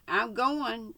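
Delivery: calm and level, no anger; without heat. Acted. The Kid repeats his plan to Mex, without heat. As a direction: say it flat and steady. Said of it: "repeated the Frio Kid without heat".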